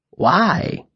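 teasing, lilting question; playful. A narrator's reading. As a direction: toy with him. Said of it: "a playful note in the voice".

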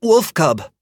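high, quick blurt; boy blurting it out. Acted. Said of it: "he blurted out".

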